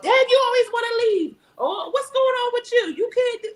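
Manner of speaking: high-pitched complaining; mock attitude. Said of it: "getting an attitude like".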